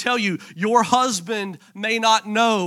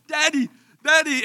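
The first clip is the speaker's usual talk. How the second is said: imitating little girl; 6 semitones higher than usual. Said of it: "she scooted ... to the front going".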